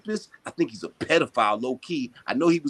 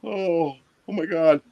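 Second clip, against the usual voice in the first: mock whining Trump; 3 semitones higher than usual. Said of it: "You always crying?".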